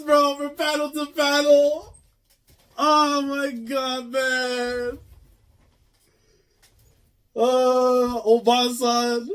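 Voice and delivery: high, loud, near-tearful cries of disbelief; losing it over the flashback, voice cracking; overwhelmed excitement. Real, not acted. Reader overwhelmed by a flashback, loud tearful cries. Reacting to emotional manga flashback.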